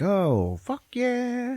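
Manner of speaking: high, drawn-out hammed-up cheer; mock elation. Teller voices Kate finding a box of keys, mock elation. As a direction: sing out a long, triumphant mock yell.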